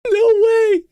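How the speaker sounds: high, loud cry of disbelief; loud incredulous outburst; disbelieving squeal. Real, not acted. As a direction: squeal it out after laughing hard. Disbelief after long laughing fit.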